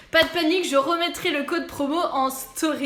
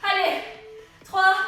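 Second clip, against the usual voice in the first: ordinary delivery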